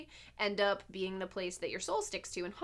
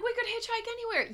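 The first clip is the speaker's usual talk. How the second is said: high mocking boomer voice; 13 semitones higher than usual. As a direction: voice jumps way up, mocking. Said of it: "They were like".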